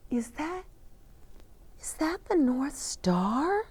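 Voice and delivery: high, breathless whispered wonder; awed whisper. Acted. Dasher amazed at the star.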